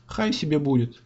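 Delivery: ordinary delivery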